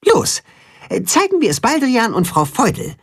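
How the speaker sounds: bright commanding little hen; high eager voice. A narrator's reading. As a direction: call the friends to action. Katzenhuhn giving orders.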